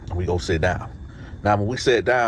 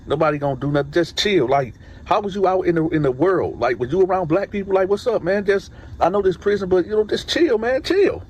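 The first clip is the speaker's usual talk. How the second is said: retold plea to chill, pitched high and climbing; exasperated urging; 9 semitones higher than usual. Inmate telling nervous Benny to relax, exasperated. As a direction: urge him to loosen up, voice rising higher and higher.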